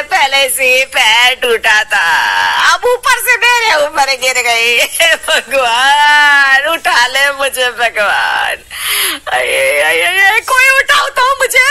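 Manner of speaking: loud, hammed-up lament; loud complaining wail. Fallen mother-in-law loudly wails for someone to lift her. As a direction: cry out dramatically to be lifted.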